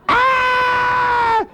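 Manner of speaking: long, very high scream; drawn-out mock scream; imitated kid scream. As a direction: shriek like a child under attack.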